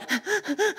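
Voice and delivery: imitated quick, high panting; mock hyperventilating in short puffs; panicked breathing. Yoga student losing their calm breathing, mock panting. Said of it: "My yoga breathing went to".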